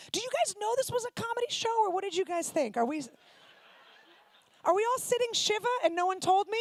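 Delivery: mock outrage at dead room; incredulous. Real, not acted. Roasting a silent audience.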